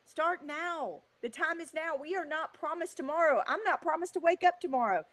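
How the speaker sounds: high, impassioned motivational push; heartfelt, high-pitched pep talk; urgent exhortation. Real, not acted. Urging listeners to start now.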